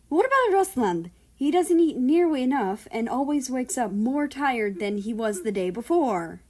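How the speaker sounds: high, loud, whiny tattling voice; high-pitched snitching sibling, loud and pleading; tattling brother. Acted. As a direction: rat out your brother in a shrill pitch. Brother tattles to their parents.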